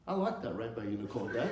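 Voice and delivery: lightly voiced boy, low and subdued; quiet little son. Son admits liking the unicorn, in a low soft voice. Young son answers dad.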